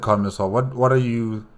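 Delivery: ordinary delivery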